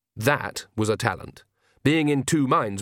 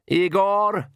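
high, raised-voice summons; shouted; 9 semitones higher, 4 dB louder than usual. A narrator's reading. The Count summons his servant Igor, shouting high. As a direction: call out sharply for a servant. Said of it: "he shouted".

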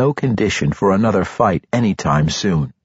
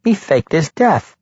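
high-pitched halfling voice, announcing; 10 semitones higher, 3 dB louder than usual. A narrator's reading. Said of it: "Regis announced".

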